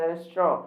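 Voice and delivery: "restaurant" as res-tro, silent ending; preferred pronunciation. Shown as a demonstration. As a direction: drop the nt of "restaurant". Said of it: "जैसे for example". ("restaurant" को 'रेस्ट्रो' बोलें)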